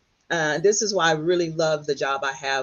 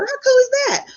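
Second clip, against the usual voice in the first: thrilled squeal; 6 dB louder than usual. Retold or imitated. Remembered thrill at the news.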